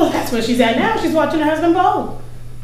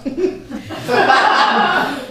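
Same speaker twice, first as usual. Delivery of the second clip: big cackling laugh. Acted. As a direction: laugh at your own joke. Neighbour laughing at own remark.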